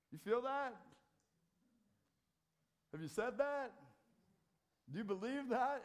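high, loud pressing pulpit questions; preaching at full force. Acted. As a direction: drive the questions home to the pews.

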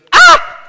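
sudden high yelp of alarm; startled shout. Retold or imitated. Old neighbour shouting in alarm at the vines. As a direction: blurt out a startled high cry. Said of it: "and he goes".

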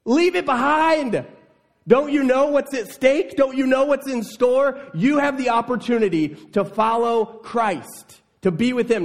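high, loud, urgent plea voiced as Jesus; Jesus urging. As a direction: plead with rising intensity. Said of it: "He says".

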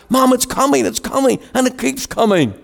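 put-on high-pitched, amazed boys calling to Mom; acted excited children reporting a wonder; aghast boys. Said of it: "I'm sure they were aghast".

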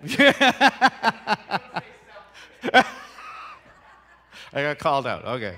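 real laugh through the words. Real, not acted. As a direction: laugh at being caught out.

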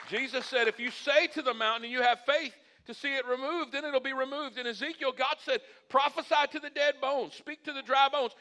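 high, loud, driving sermon delivery; full-force preaching. Acted. Pastor declaring who God says he is, full-force preaching. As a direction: build declarations like a fired-up preacher.

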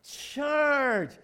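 loud, high battle cry; rally the crowd with a yell; shouted charge. Shown as a demonstration. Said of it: "Come on".

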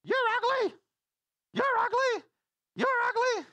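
very high, loud parrot screech; squawking parrot. Acted. Parrot insulting a customer in the hardware store, squawking. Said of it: "the parrot looks and goes".

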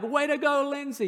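high-pitched cheer without full volume; half-hearted imitation of a crowd shout; mock yell. Father trying out a cheer for the graduate, mock yell. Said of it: "do I yell out words like".

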